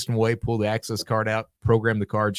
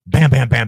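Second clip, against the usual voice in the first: banging out a loud knock, bam bam bam; imitated police pounding; loud mock cop knock; 4 semitones higher, 9 dB louder than usual. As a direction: pound the words like a fist on the door.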